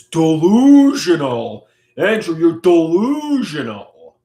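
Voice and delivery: loud, drawn-out taunting scold; mocking loud quote. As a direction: belt out the accusation, slowly. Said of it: "Like Pizza Pasta says".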